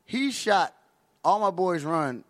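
ordinary delivery

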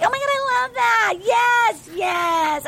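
high, gushing mock delight; excited high-pitched retelling, yeses ringing out; thrilled squeal. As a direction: gush like a swooning contestant. Retelling her delighted reaction.

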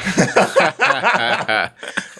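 a burst of high laughter; real amused laugh. Real, not acted. Laughing at own story.

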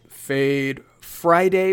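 segment title drawl. Acted. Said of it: "our third and final segment".

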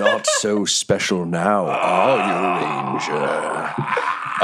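slow, drawn-out villain gloat; taunting villain. Acted. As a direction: draw the taunt out slowly. Game master voices taunting villain.

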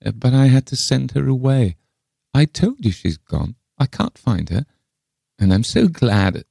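put-on high-pitched old woman confiding; cunning eccentric old lady. A narrator's reading. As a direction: pitch it up, sly and self-satisfied. Reader voices eccentric Miss Le Fane.

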